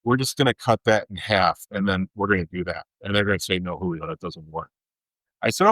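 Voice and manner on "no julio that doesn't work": soft, curt voice of the dietitian; dismissive dietitian. Retold or imitated. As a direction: brush him off quietly like a bored expert. Dietitian voiced rejecting Julio.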